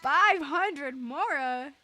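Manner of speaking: slow, incredulous question; surprised. Real, not acted. Streamer getting money from Paimon, slow disbelief. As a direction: stretch it out in disbelief.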